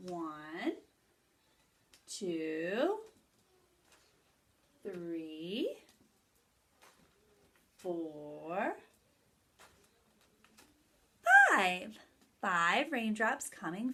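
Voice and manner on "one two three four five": slow counting, each number held and rising; slow counting aloud. Shown as a demonstration. Teacher counting raindrops for children, slow rising count. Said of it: "I'm gonna make five raindrops".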